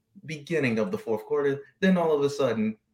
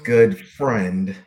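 sarcastic; 6 dB louder than usual. Acted. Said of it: "and I use those in brackets".

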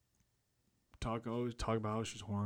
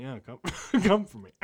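laughing through it; 6 semitones higher, 8 dB louder than usual. Retold or imitated. Laughing at his own retold line.

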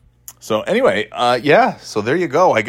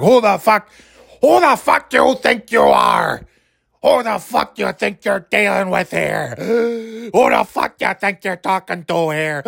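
very high, strained wrestler rant; Roddy Piper; 10 semitones higher than usual. Acted. Wrestler rants at his rival on podcast, overwrought impression. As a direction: rant as if about to pass out. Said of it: "that's how every time Piper would say something".

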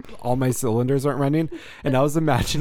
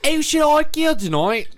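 very high, louder caricature; hammed-up rom-com diarist, pitched way up; Bridget Jones diary voice; 5 dB louder than usual. Repeating the serial killer's diary line, hammed-up high voice. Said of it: "as Bridget Jones's Diary".

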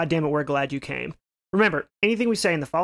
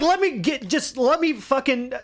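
put-on pleading teen; 6 semitones higher than usual. Acting out the boy's plea.

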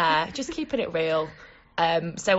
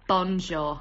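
mocking a friend's awkward French; stilted Bonjour in clumsy English-accented French; awkward French imitation; 3 semitones lower than usual. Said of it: "when you try and speak a different language".

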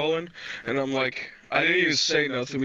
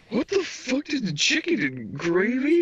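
higher, slowed incredulous voice; baffled disbelief; 10 semitones higher than usual. Retold or imitated. Retelling his shock at chicken with gravy, high baffled drawl. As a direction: play it baffled, pitched up.

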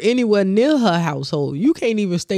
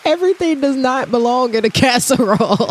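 exasperated; 8 semitones higher, 5 dB louder than usual. Real, not acted. Food rant, laughing.

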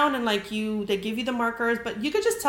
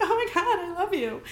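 giddy gush; 9 semitones higher than usual. Retold or imitated. Answering the bartender's compliment, a giddy high squeal.

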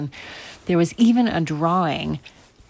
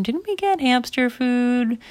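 put-on higher, slow questioning child; hesitant child's query; 8 semitones higher, slower than usual. Brother doubts sister feeding jelly beans, hesitant questioning.

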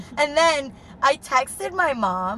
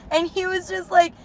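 normal talking voice

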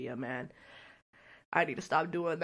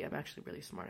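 whispered aside; sly whispered aside; 14 dB quieter, faster than usual. Real, not acted. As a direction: drop to a whisper for the joke.

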